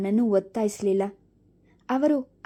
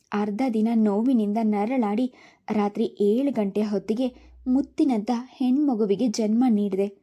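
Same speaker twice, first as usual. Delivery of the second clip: plain reading voice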